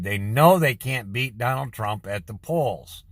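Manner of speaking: normal talking voice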